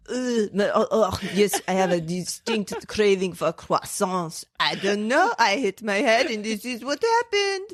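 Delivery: thick, hammed-up French accent; badly faked French, overdone; horrible French accent. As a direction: play a bewildered fake French speaker. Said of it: "horrible French accents".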